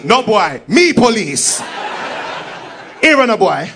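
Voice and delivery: loud patois assertion of authority; hammed-up Jamaican officer correcting someone; Jamaican policeman. Policeman correcting confused tourist, loud Jamaican accent. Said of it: "One policeman come out of the bush".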